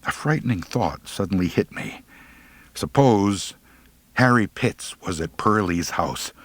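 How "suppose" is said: plain reading voice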